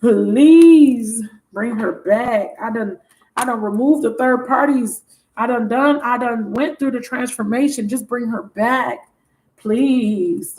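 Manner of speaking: drawn-out acted plea to God; pleading prayer. Voicing a man praying for her return, slow pleading. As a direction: beg slowly, leaning long on please. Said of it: "He's been saying".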